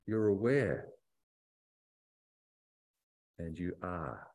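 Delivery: soft, unhurried meditation voice with long pauses; guided reflection. Acted. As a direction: invite them inward, gently. Leads a short reflection.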